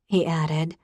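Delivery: ordinary delivery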